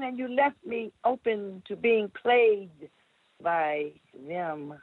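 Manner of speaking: measured, rhythmic poetry performance; dramatic spoken word delivery; spoken word poem. Acted. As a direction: perform the poem with slow weight.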